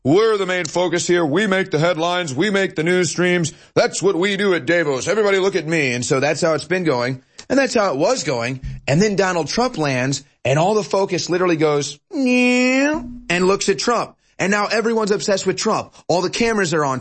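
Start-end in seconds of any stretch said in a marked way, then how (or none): 0.0-5.9 higher, smug bragging voice; higher-pitched hammed-up snob; pompous Davos elite. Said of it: "that are". 12.1-13.0 squeaky high meow noise; a sudden high cartoon meow; high mock meow. Imitated whoosh of attention.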